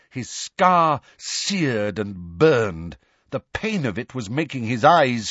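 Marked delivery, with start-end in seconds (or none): none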